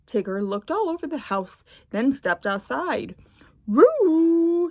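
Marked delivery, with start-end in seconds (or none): 3.7-4.7 a high, loud yell outdoors. Acted. Tigger yelling for Roo outside, loud yell. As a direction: yell his name into the yard. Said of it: "he yelled".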